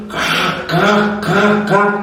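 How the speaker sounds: high, sharp "kak" bird calls; bird caw. Retold or imitated. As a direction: imitate the bird cawing in high, short bursts. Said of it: "suara burung yang ... datang lagi". (tiruan suara burung bernada tinggi)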